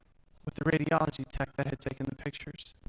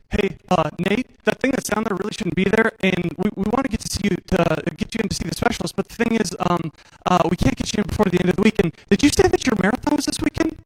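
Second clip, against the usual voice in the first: stammering, rushed, louder and higher, retelling a rattled doctor; panicked doctor; 5 semitones higher, 11 dB louder than usual. Retold or imitated. Doctor phoning the patient, panicked stammer. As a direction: imitate the doctor losing his calm. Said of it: "doctors that seem panicked, much less stutter".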